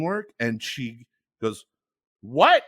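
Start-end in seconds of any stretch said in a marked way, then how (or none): 2.2-2.6 loud, higher-pitched disbelief; baffled what. Retold or imitated. Scene partner baffled by a thick accent, mock disbelief. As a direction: retell a startled, baffled question.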